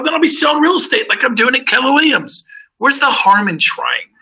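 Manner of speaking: pitched well up, pressing the point; heated retort. Retold or imitated. Retelling his comeback to the regional owner, pitched high and insistent. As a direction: push back hard at the doubting boss. Retold argument with broker Dick.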